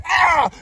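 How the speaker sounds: sudden high yelp as the water hits; a sharp, high startled cry; retold yelp. Telling friends about getting splashed in the face, a loud startled yelp. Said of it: "I'm like".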